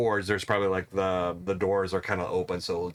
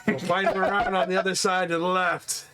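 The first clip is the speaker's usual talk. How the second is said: guard voice laughing; 9 semitones higher than usual. Acted.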